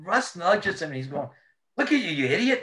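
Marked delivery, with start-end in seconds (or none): 1.8-2.6 teasing friend. Retold or imitated. Russ voiced teasing.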